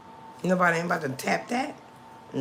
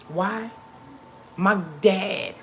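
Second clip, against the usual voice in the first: normal talking voice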